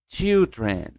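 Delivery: put-on Children with a ch like T; model pronunciation. Shown as a demonstration. Teacher models children for students. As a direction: say Children, tch start. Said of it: "De novo".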